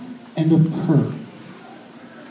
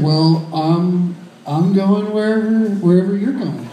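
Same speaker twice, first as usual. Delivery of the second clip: mock smooth. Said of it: "Be smooth".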